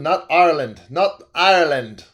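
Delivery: loud, hammed-up attempt at an Irish accent; badly faked Irish brogue on Ireland; terrible Irish accent. Shown as a demonstration. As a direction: try a bad Irish accent. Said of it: "Terrible accent".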